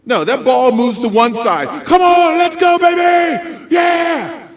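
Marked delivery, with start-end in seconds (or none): 1.8-4.5 loud, high, drawn-out shout of encouragement; fan cheering. Acted. Speaker acts out fans cheering a ball, loud cheer. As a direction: whoop like a fan in the stands.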